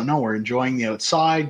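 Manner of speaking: normal talking voice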